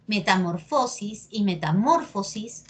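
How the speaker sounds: metamorFOsis, then metaMÓRfosis; first metamorfosis on FO, second on MOR; two accepted stresses. Shown as a demonstration. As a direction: show the stress moving back in metamorfosis. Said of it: "depende de ti cómo lo quieras decir".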